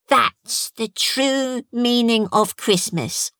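put-on slow, high, proud child; a boy pleased with himself; proud little boy. A narrator's reading.

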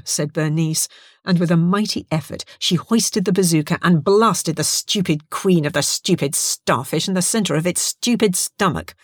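usual speaking voice

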